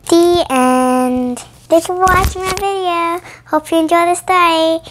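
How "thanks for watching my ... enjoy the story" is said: ordinary delivery, nothing marked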